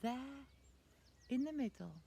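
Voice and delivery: hushed, suspenseful whisper. A narrator's reading. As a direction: whisper toward the reveal. Suspenseful reveal.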